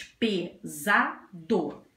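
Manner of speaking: "Pesado" in three beats, "Pe... za... do"; syllable by syllable. Shown as a demonstration. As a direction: count out the syllables of "Pesado" with pauses. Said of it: "três sílabas".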